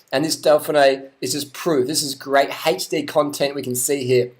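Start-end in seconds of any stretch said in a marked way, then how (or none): none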